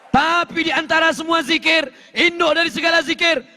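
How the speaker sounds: high-pitched, driving sermon delivery; full-force preaching. Real, not acted. Preacher urging congregation to seek forgiveness, full-force preaching. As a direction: hammer out each repeated phrase high and insistently.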